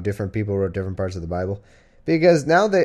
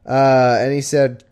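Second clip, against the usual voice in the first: usual speaking voice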